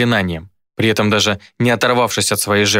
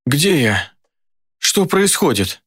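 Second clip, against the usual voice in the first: slow, high, fearful confusion; a man waking in terror, asking where he is; frightened; 5 semitones higher, much slower than usual. A narrator's reading. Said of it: "Он испуганно озирался по сторонам".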